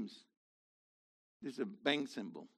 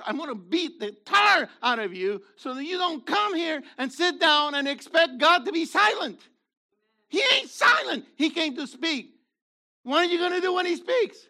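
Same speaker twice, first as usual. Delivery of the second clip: loud, high fiery preaching, rising to a shout; preaching at full force; 12 semitones higher, 12 dB louder than usual. Acted. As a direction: thunder it at the congregation.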